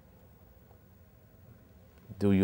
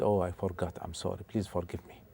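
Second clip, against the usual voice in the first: much softer, a meek hushed plea; soft apology; 5 semitones lower, 15 dB quieter, faster than usual. Acted. Musa meekly begging Al-Khidr's forgiveness, very soft. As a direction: say sorry almost under your breath.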